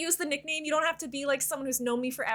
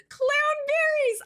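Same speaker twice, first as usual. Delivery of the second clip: excited squeal; 12 semitones higher, 4 dB louder, slower than usual. Real, not acted. Greeting a chatter by name.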